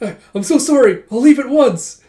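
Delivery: flustered, high, loud apology; embarrassed. Acted. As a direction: blurt it out, mortified and eager to flee. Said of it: "He gets all embarrassed".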